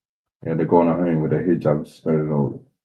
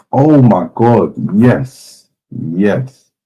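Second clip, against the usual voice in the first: slow, loud, delighted oh my God; loud, drawn-out excited yes; excited gasp; 4 semitones higher, 9 dB louder, much slower than usual. Real, not acted. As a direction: burst out thrilled at finding it. Excited on finding the video.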